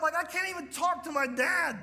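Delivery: ordinary talk, nothing marked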